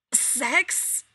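rasped. A narrator's reading. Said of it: "he rasped".

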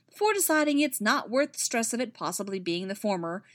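plain reading voice

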